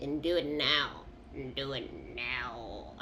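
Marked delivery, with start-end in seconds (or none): 1.6-2.9 low and soft, slowed right down; quiet slow insistence; hushed command. Acted. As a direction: drop to a near whisper, insisting. Urging quietly after shouting.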